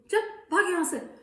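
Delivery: high, loud scold; granny shooing monkey away. Acted. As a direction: send him packing.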